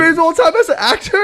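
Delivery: high-pitched disbelieving question; incredulous. Real, not acted. Friend challenging the greatest-of-all-time claim, incredulous.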